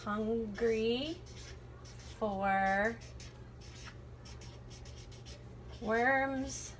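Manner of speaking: slow, drawn-out words with long gaps; writing while speaking. Real, not acted. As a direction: say each word as you write it. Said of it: "let me write that down".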